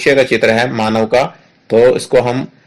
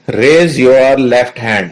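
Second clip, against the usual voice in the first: each word drawn out, a touch louder; slow and clear; 3 dB louder, much slower than usual. Shown as a demonstration. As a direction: read the command aloud slowly for the class. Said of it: "इनको हमने जोर से बोलना है".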